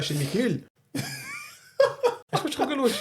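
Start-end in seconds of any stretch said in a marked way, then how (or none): 0.9-1.5 sudden high-pitched laugh bursting out; burst of laughter. Real, not acted. Storyteller cracks up at his friends' teasing, laughing. 2.3-3.0 high, laughing question. Real, not acted. Storyteller asks his friend if he's laughing, laughing.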